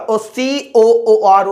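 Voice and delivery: each letter of सीओओओएच stretched very slowly; drawn-out dictation. Shown as a demonstration. Teacher dictating the formula to students, very slowly drawn out. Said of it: "teacher drawing out formula letters".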